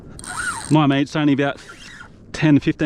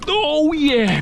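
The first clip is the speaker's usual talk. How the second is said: excited; 13 semitones higher than usual. Real, not acted. Fish coming aboard.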